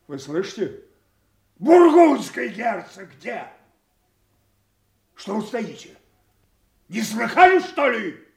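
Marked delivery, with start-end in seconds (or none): 1.6-3.5 high, loud angry shout; enraged king shouting for Burgundy; furious shout. Acted. 5.1-8.3 higher, loud scolding; angry outburst. Acted. King berates idle courtiers.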